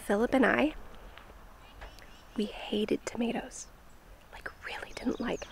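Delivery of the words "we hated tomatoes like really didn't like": whispering. Real, not acted. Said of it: "I have a secret".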